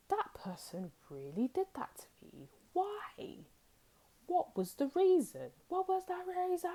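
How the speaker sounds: high, puzzled questioning, imitating a hurt viewer; mock indignant rumination. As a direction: act out someone obsessing over why. Viewer replaying a betrayal.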